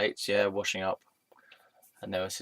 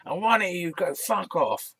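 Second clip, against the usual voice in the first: loud angry yell; 11 semitones higher, 5 dB louder than usual. Retold or imitated. Rich customer yelling at the waiter, loud and high. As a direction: bellow it down at the waiter. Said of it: "really loudly".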